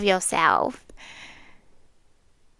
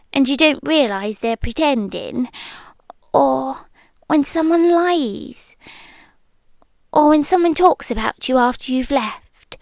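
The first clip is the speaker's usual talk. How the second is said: normal talking voice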